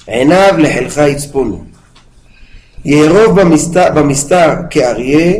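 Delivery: brisk, steady reading of Hebrew verse; psalm recitation. Acted. Teacher reading the Tehilim verses to the class, brisk recitation. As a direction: run through the verses quickly, like a familiar text.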